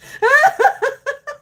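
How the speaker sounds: high squealing laugh; high laughter. Real, not acted. Laughing hard.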